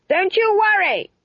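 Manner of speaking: high scream above crackling flames; very high-pitched, straining call. A narrator's reading. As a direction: scream it to carry over the noise. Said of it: "Granny Weatherwax screamed above the crackle of flames".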